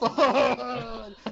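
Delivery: high, put-on obnoxious laugh; Seth Rogen. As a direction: laugh obnoxiously at your own joke. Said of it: "that was more of a Seth Rogen laugh".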